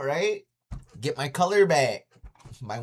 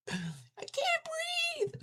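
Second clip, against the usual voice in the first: much higher squeezed voice, straining for air; choking kid; 6 dB quieter, much slower than usual. Retold or imitated. Kid choked over the bus aisle, squeezed and gasping.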